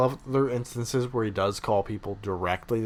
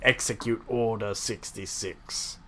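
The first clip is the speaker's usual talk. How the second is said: flat Palpatine order; 8 dB quieter, slower than usual. Retold or imitated. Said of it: "He would just say".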